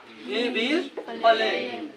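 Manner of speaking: he will play, each word drawn out very slowly; slow English model. Shown as a demonstration. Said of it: "teacher models English slowly". (धीरे-धीरे साफ़ बोलकर अंग्रेज़ी वाक्य)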